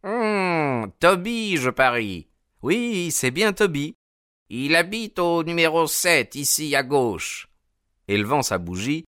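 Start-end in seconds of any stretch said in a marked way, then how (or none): 0.1-7.5 recognize the dog with satisfaction; old animal keeper, pitched well up; knowing old man. A narrator's reading.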